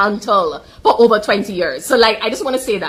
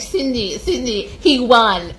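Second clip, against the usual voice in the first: slow, drawn-out mock correction of Cindy; sarcastic mock voice, stretched out slowly; mocking an opponent; 3 semitones higher, slower than usual. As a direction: tease her like a know-it-all fan. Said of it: "you're gonna be like".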